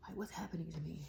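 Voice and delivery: a private, breathless oh gosh; mock worried whisper; whispered inner thought. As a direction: whisper it to yourself, half alarmed. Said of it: "And I'm like".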